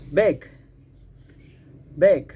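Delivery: "Bag." with a firm voiced G at the end, said loud; clear final G. Shown as a demonstration. Teacher drilling pupils on the final G of "Bag.", loud and firm. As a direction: land hard on the G in "Bag.". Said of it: "Bunyi belakangnya G ya".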